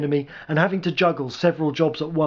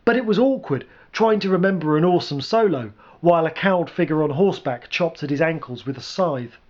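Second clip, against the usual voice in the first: ordinary delivery, nothing marked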